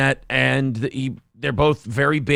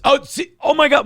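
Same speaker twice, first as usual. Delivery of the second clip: excited; 11 semitones higher, 3 dB louder than usual. Real, not acted. Reacting to friend's impression.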